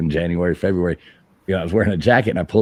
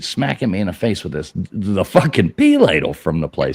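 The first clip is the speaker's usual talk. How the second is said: normal talking voice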